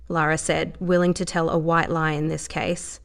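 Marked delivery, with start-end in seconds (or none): none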